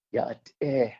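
"Yat eh" with the "eh" drawn out and pushed up; stretched Navajo word. Shown as a demonstration. Teaching the host how the word is stretched, drawn-out "Yat eh". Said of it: "We stretch words".

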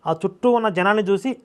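normal talking voice

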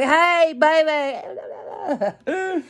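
guy in a Mustang hollering at a woman he passes; catcalling stranger. Retold or imitated. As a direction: call out like a flirting driver from a car. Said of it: "But they was like".

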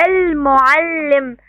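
"المعلم" drawn out very slowly, leaning on the doubled ل; slow teacher's demo of "المعلم" with a held, doubled ل; showing the shadda. Shown as a demonstration. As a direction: stretch "المعلم" so the doubled ل stands out. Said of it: "الحرف اللي عليه شدة ايه".

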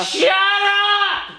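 high yelled shut up; shouting. Real, not acted. Yelling over singer.

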